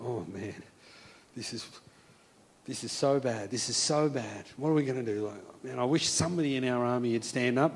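low, hushed dismay; quiet, hopeless soldier's grumble; intimidated, discouraged. Acted. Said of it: "felt completely overwhelmed and intimidated".

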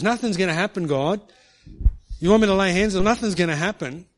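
loud, exasperated arguing; arguing with God. Retold or imitated. Preacher arguing with God on the stairs, loud and exasperated. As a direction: insist loudly nothing will happen. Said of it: "I was arguing with him".

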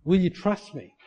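ordinary talk

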